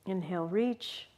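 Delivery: ordinary talk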